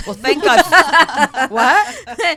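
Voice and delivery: loud laughing exclamation. Real, not acted. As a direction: burst out laughing. Laughing at the joke.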